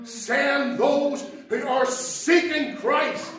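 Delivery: a loud call of "Lord", then a slow plea; loud fervent prayer. Shown as a demonstration. Preacher showing how a church should pray, loud plea. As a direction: plead it out, slow and earnest. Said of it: "We should be praying".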